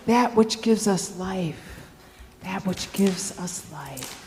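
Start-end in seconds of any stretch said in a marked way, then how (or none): 2.4-4.2 lower, much softer repeat; quiet, grave echo; hushed echo. Acted. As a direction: let it sink in, low and soft.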